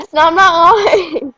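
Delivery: whiny laughing protest; crying with laughter, real. Real, not acted. Reacting to skunk flavor.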